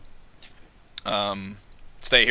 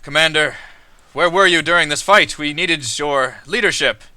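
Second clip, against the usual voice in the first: stern captain; 7 semitones higher, 9 dB louder than usual. Acted. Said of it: "She says".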